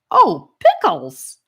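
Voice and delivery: high, slow, loud burst of surprise; boy tasting a strange sauce, loud surprised cry; surprised delight. Acted. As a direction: shout it like a sudden happy discovery.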